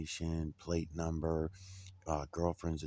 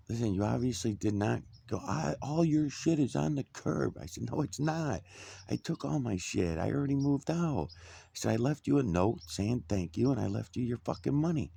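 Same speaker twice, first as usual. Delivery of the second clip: heated retort; 6 semitones higher, 3 dB louder than usual. Retold or imitated. Retold phone argument with landlord.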